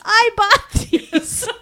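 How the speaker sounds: high, slow, laughing bewilderment; confused kid. Retold or imitated. Little girl realising the gift was hers, high slow confusion. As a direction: slowly puzzle it out through laughter. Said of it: "So confused".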